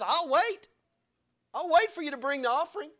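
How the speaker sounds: Jesus insisting. Acted. Jesus to the worshipper, high and loud. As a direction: call out that he'll wait. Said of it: "Jesus says".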